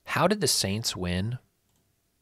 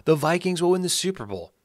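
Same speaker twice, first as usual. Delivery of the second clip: imitating critics; 8 semitones higher than usual. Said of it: "And they've said".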